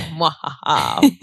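slow evil cackle; villainous mwahaha; mad scientist laugh. Retold or imitated. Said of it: "And I'm like".

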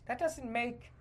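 ordinary talk

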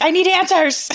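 high-pitched, playful plea; mock desperate demand. Friend joining the protest over a mystery show, mock desperate.

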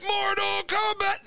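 hammed-up high holler; mock game announcer. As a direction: cry out the game title like its theme. Calling out the game's title.

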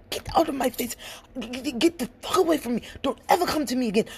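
high, fast, stuttering mock tirade; rapid-fire cursing out. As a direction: spit the insults out too fast to follow. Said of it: "That's how fast he was talking".

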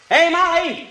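loud call across the house; husband calling to wife in another room, loud call. Acted. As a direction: call her from the kitchen. Calling to Molly in bedroom.

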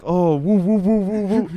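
high, loud whooping audience member; cheering crowd. Pretend. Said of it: "And you're like".

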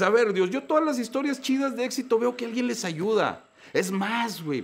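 telling God off. Retold or imitated. Speaker scolding God for never helping him, exasperated. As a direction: give God a piece of your mind. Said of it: "Le dices".

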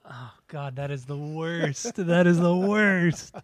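slow drawn-out disgust. Real, not acted. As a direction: groan it out slowly.

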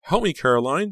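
high, loud plea for help; desperate cry. A narrator's reading. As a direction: beg a friend to save you. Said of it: "she cried".

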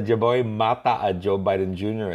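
usual speaking voice

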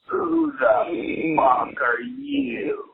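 put-on high, slow, drawn-out demand from a toothless young man; startled stranger. Toothless stranger demands who the guest is, high and slow. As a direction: stretch each word out, high and incredulous.

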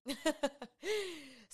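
short laugh falling away; embarrassed laugh. Real, not acted. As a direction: laugh it off at herself. Laughs at her own rant.

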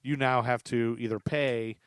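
normal talking voice

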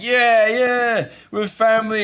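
high-pitched cheering agreement; cheering crowd. Acted. Crowd answering the recruit's speech, high cheer. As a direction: call back like an approving crowd. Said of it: "You hear other people go like".

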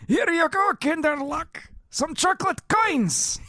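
high old cartoon grandpa; croaky old grandpa with overtone; Grandpa Boris voice.

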